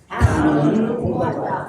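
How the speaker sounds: words stretched out for repeating; slow group drill. Shown as a demonstration. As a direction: lead the class slowly through it. Leads the audience in slow repetition. (따라 하도록 길게 늘여서)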